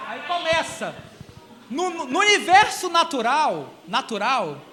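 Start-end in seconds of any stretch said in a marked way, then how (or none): none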